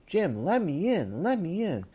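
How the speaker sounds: put-on high, pleading small-child voice; whiny little kid begging to be let in. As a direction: beg like an overtired child having a tantrum. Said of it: "talking like a small child".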